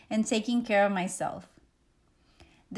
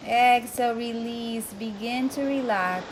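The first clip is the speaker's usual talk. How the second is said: guided meditation; 4 dB quieter than usual. Acted. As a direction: ease the listener into calm, one breath at a time. Leads a breathing meditation.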